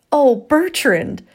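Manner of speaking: high, loud shocked gasp of a name; drawn-out, high-pitched gasping exclamation. A narrator's reading. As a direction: exclaim it in scandalized dismay. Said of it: "Eleanor gasped".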